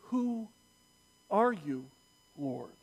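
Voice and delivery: hushed, halting, falling away; awed question. Acted. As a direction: ask it softly with pauses between words. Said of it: "Paul says".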